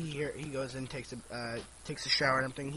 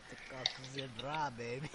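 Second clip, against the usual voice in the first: mock repeat; 6 semitones lower, 9 dB quieter than usual. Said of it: "That's funny".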